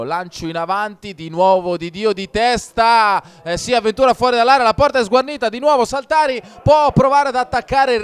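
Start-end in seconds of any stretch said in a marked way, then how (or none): 1.1-8.0 higher and louder, fast build; excited commentary. Real, not acted. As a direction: rush the chance as it develops. Dangerous chance in live commentary.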